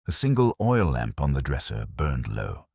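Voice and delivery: ordinary delivery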